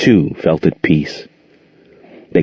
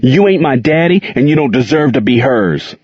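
angry outburst, raised and high; 6 semitones higher than usual. A narrator's reading. Boy defies returning abusive father.

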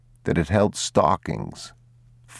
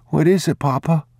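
put-on boy's voice, much higher, urgent question; a scared boy asking his father; boy asking Papa what is coming; frightened child; 11 semitones higher, 3 dB louder than usual. A narrator's reading. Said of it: "boy frightened by the trembling".

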